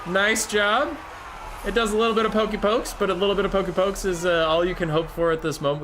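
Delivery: high-pitched commentary call, easing slightly; excited play-by-play. Real, not acted. Caster commenting on a Burning Head hit, excited play-by-play. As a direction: announce the spell from up high.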